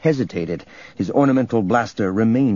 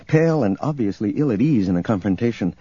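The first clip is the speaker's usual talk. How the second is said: usual speaking voice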